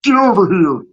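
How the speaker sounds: a light take on a game ninja's battle call; Scorpion voice. Retold or imitated. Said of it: "In my Mortal Kombat Scorpion voice".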